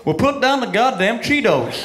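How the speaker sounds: slow, drawling blunt TV host; blunt Doctor Phil. Retold or imitated. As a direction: lay it down slow like a folksy TV doctor. Doctor Phil answering the guest.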